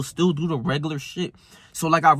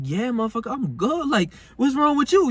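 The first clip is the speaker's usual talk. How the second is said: rising higher and higher in disbelief; incredulous comeback; 8 semitones higher than usual. Retold or imitated. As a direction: toss it back, voice climbing. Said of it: "I'm like".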